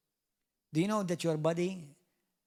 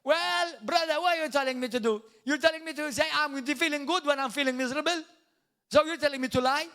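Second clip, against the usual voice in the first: higher, louder whiny protest; mock complaining churchgoer; 9 semitones higher, 5 dB louder than usual. Preacher mimics a congregant objecting to him, whining. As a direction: mimic a defensive churchgoer pushing back. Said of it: "Many people tell me that".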